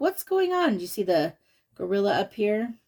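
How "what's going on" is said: much higher, lightly voiced confused Jim; puzzled monkey. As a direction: squeak it out, baffled. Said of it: "he's like".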